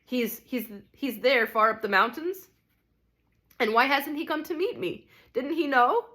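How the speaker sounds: understated fierce ghost; almost fierce. A narrator's reading. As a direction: demand answers with restrained ferocity. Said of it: "and then almost fiercely".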